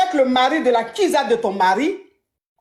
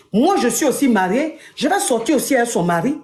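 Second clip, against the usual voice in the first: ordinary talk, nothing marked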